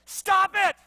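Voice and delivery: high, very loud command; commanding shout. Acted. Jesus rebukes the wind and waves, booming shout. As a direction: bellow at the storm to stop. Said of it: "this is what he says".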